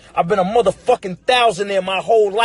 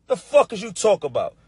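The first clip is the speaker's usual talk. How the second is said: ordinary talk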